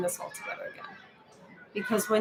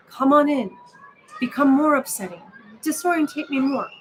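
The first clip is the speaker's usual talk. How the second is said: higher and louder, inviting the upset in; mock welcoming; 4 semitones higher, 5 dB louder than usual. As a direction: playfully open the door to the feeling. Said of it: "meet them at the door laughing".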